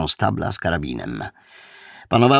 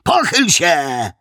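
high, drawn-out barked command; called-out order; 8 semitones higher, 4 dB louder, slower than usual. A narrator's reading. Junior inspector orders the suspect to bend, called-out order. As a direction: stretch the order out in a raised voice. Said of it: "zawołał młodszy inspektor".

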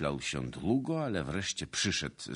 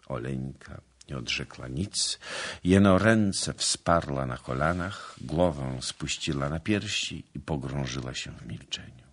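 plain reading voice

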